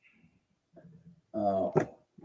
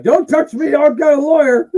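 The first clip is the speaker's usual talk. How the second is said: high, louder mock-offended announcer; huffy Mean Gene; 11 semitones higher, 7 dB louder than usual. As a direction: bluster like a fussy man being shoved. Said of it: "he'd always be like".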